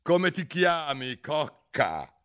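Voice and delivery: loud, slow, high-pitched queen's question; imperious queen. A narrator's reading. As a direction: demand it slowly from on high. Impatient queen questions Alice. (regina imperiosa e altezzosa)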